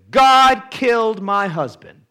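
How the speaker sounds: high, loud anguished outburst; retold anguished cry. Grieving widow tells the counselor, loud anguished cry. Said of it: "she says".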